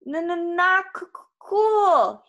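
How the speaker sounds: high, loud, juddering stutter; vibrating yell. A narrator's reading. Grover yelling from the million hand massage, voice vibrating. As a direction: yell as if being shaken all over. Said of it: "he yelled, his voice vibrating".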